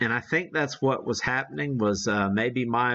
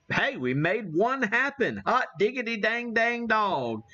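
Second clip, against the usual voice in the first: high, delighted exclamation; excited whoop; 9 semitones higher than usual. Real, not acted. Radio contact finally succeeds.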